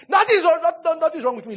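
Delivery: high, loud protest that all is fine; loud insistence. Retold or imitated. Pastor brushing off worried churchgoers, high and loud. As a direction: cry out that nothing is wrong, high and loud.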